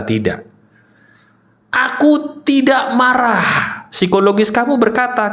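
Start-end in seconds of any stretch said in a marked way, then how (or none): 1.7-3.9 slow, higher-pitched recital; drawn-out demonstration statement; deliberate self-affirmation. Shown as a demonstration. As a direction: stretch each word out slowly.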